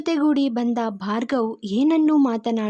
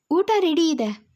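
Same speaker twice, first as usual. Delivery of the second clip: calling out; 6 semitones higher than usual. Acted. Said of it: "ಕರೆದಳು".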